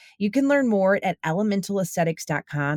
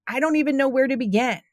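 higher, fast, lost for where to start; flustered mock quote; overwhelmed; 7 semitones higher, much faster than usual. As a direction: voice someone overwhelmed, pitched up and quick. Voicing an overwhelmed listener.

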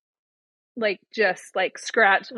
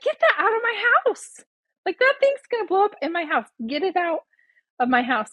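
high-pitched, panicky mom order; retold alarmed scolding; 8 semitones higher than usual. Mom to son with overpumped ball, acted high-pitched alarm. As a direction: re-enact shooing the danger out.

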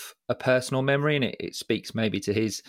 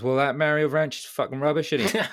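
put-on old boy grumbling; 6 semitones higher than usual. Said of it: "his response was".

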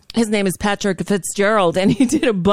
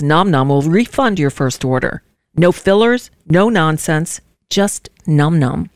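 upbeat sponsor read, a bit louder; 2 semitones lower, 4 dB louder than usual. Acted. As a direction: sell the product brightly to listeners. Host reads a sponsor ad.

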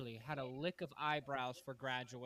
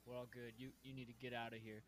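low, much softer laid-back brush-off; cool dismissal; 6 semitones lower, 16 dB quieter than usual. Retold or imitated. Day-room boss turning away helpers, low soft cool. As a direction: wave them off, club-owner cool. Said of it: "I'd be like".

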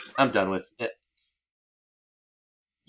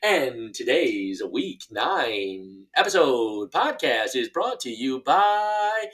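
mock ad read; much slower than usual. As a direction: announce the sponsor like a hammed-up commercial. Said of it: "Hit us with your advertisement".

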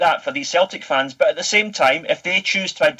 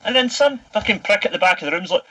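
ordinary talk, nothing marked

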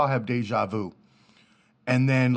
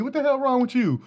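mock outrage; 12 semitones higher than usual. Angry fan scolds mascot.

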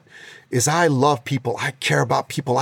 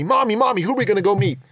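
lightly voiced eager little boy, a touch higher; 7 semitones higher, faster than usual. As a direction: chirp it like an excited small child asking mommy. Mother quotes her little son.

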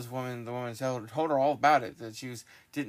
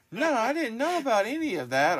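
flustered denial; 10 semitones higher, 8 dB louder than usual. Retold or imitated. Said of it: "he's like".

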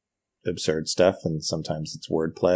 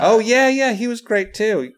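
pitched way up, bursting with agreement; eager agreement; 14 semitones higher, 4 dB louder than usual. Real, not acted. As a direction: jump in delighted, voice much higher. Eager agreement about Stephen Fry.

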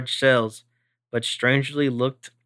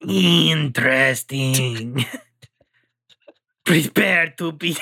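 menacing line breaking into laughter; slower than usual. Acted. Alien captor breaking into laughter.

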